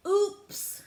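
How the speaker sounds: ordinary talk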